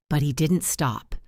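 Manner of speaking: plain reading voice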